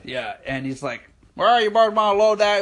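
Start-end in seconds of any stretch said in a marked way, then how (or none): 1.4-2.6 higher, louder put-on cowboy voice; cowboy customer. Imitating cowboy customer collecting his armoire, cowboy voice. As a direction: holler it like a cowboy.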